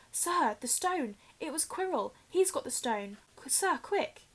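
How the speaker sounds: put-on waking boy urging the headmaster; urgent panic. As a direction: blurt it out in panic.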